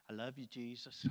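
soft, low, sheepish voice; meek retreat. Retold or imitated. Preacher answers the Lord after being caught whining, meek retreat. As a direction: back down quietly, mock-innocent.

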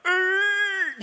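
high, loud squeal of a slip. Acted. Preacher reenacts slipping off a ladder, high squeal. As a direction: squeal as the foot misses the step.

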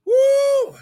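high, loud, sustained "woo"; ecstatic whoop. Real, not acted. Preacher awaiting someone's breakthrough, ecstatic whoop. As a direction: whoop out in praise.

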